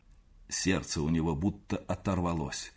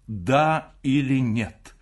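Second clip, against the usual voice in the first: slow, tense question, each word weighed; притаив дыхание; 3 semitones higher, 6 dB louder, much slower than usual. A narrator's reading. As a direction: demand it slowly and louder, in suspense. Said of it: "притаив дыхание". (медленно, напряжённо, с замиранием)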